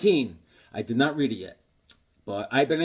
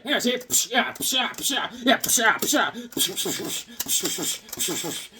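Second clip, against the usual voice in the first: higher, puffing psh sounds; toy Superman noises; 6 semitones higher than usual. Pretend. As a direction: play with the toy, hissing psh punches. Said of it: "he's like".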